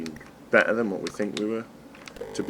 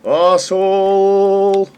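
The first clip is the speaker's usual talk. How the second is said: long, drawn-out, high furious yell; abusive shout; 10 semitones higher, 9 dB louder, much slower than usual. Real, not acted. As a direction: bellow the insult at the game. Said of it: "grunting and screaming and abuse".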